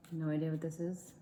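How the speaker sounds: talking through a mouthful; words round a bite of food; mouth full. Real, not acted. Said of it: "the way that I'm speaking with my mouth full".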